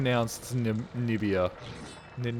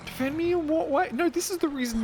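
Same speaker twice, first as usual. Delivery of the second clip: mock voice. Mock dialogue, voicing himself.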